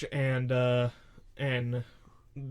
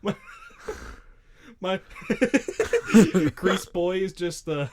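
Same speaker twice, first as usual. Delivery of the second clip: laughing; 3 semitones higher, 5 dB louder than usual. Real, not acted. Laughing through the joke.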